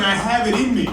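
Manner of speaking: ordinary talk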